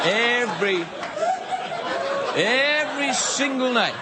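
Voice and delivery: EVERY stretched high and slow; emphatic repeat. Real, not acted. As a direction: lean hard on "every".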